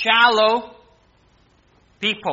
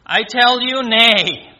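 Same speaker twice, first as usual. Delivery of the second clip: loud, high proclaimed refusal; emphatic denial; 5 semitones higher, 8 dB louder, slower than usual. Acted. Preacher voicing Jesus' answer about peace, loud emphatic denial. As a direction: ring out the denial from the pulpit.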